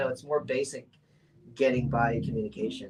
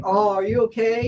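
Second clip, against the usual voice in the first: mock concerned parent; 10 semitones higher, 11 dB louder than usual. The caring reply she hoped for.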